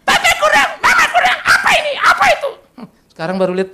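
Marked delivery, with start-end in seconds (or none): 0.0-2.5 put-on very high, shouted childish complaint; bratty child shouting. Young pastor yelling demands at parents, high shout. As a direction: yell like a spoiled kid. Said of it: "saya masih anak-anak".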